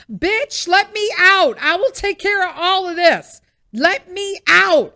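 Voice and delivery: loud, high outburst; explode, demanding to be let out; angry Denise. Acted. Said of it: "old festering, angry Denise is just like".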